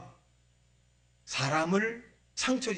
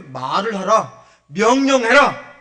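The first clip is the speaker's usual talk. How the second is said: loud, drawn-out order; commanding; 4 semitones higher, 13 dB louder, slower than usual. Acted. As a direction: build to a high, forceful command. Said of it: "하나님 뭐라 했어요?".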